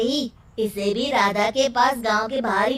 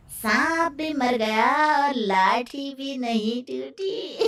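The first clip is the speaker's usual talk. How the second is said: ordinary delivery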